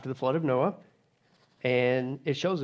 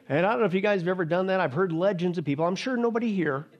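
normal talking voice

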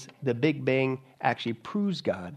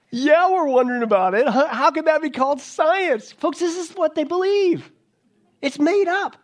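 louder and higher mocking outburst; preacher ridiculing the quote, loud and high; indignant outcry; 11 semitones higher, 6 dB louder than usual. Real, not acted. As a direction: call it out to the congregation, scornful.

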